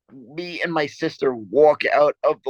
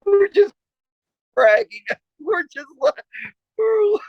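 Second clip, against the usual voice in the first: high squeals of laughter breaking through the words; can barely get the words out for laughing. Real, not acted. Retelling the liquor store exit to his co-host, laughing hard.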